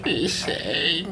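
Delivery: soft, tearful whimper; frail father whimpering to his child; weak whimper. Acted. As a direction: barely get the word out through tears.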